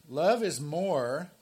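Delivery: slow, loud, deliberate reading of the quote; emphatic quote reading. Shown as a demonstration. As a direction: lean on every word of the quote. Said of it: "I, I like this quote, so I'm just gonna read it".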